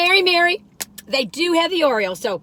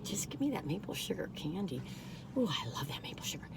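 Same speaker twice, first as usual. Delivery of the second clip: soft unvoiced longing whisper; whispered wish; 5 semitones lower, 16 dB quieter than usual. Real, not acted. Telling viewers her Christmas candy wish in a whisper. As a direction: breathe it like a secret craving.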